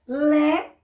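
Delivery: "Lé" loud and drawn out; isolated, emphatic "Lé"; stressed syllable. Shown as a demonstration. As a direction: hold "Lé" as the strong syllable. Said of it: "é a sílaba forte".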